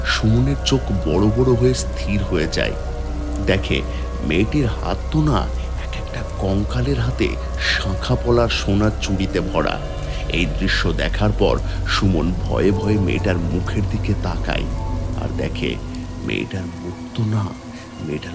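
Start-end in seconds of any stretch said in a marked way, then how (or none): none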